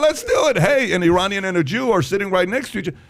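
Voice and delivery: very high, exuberant change of heart; mock enthusiasm. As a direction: throw caution away in a loud, squeaky voice. Acting out cheerful change of mind.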